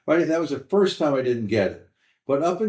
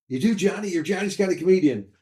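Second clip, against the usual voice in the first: higher, optimistic, run together; encouraging fans; 6 semitones higher, faster than usual. Retold or imitated. Audience members telling the comic after shows, encouraging. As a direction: imitate eager fans urging him on. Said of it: "people are just telling me".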